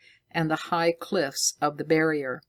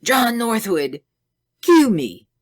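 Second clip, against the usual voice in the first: loud, desperate plea, higher; passionate plea; 7 semitones higher, 7 dB louder than usual. A narrator's reading. Scientist begging Northwood to kill him, loud and desperate. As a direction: beg him to end it. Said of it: "turned passionately to Northwood".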